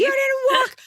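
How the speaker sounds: imitating the guard screaming at her in line; screeching guard. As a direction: shriek it like a furious guard. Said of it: "guard voice again".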